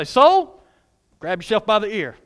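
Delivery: firm, punchy order to yourself; stern self-talk. Acted. Preacher talking the soul into praise, loud and commanding. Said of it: "talk yourself into it".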